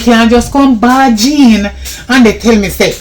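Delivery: angry scolding. Acted. Helper scolds the stranger at the door, loud and angry.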